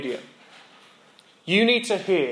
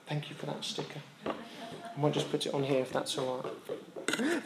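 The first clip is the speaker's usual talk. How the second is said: quiet aside; 13 dB quieter than usual. Real, not acted. Aside to child during sermon.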